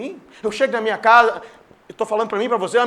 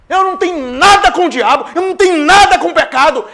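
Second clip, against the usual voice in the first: loud, higher preaching declaration; preaching shout; 6 semitones higher, 10 dB louder than usual. Real, not acted. Preacher declaring to the church, loud and higher. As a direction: proclaim it with full force.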